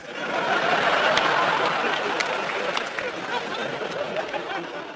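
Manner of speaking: soft high unvoiced laugh; soft, high breathless laugh; high breathy laugh. Real, not acted. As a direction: let out an airy, high laugh. Laugh after edited clip.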